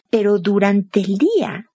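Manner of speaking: ordinary delivery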